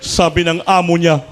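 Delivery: ordinary talk, nothing marked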